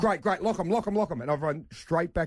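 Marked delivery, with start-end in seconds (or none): 0.0-1.2 high, hurried repeated urging; retold frantic agreement, pitched up; urgent. Dad urging the lady to lock the exits, urgent.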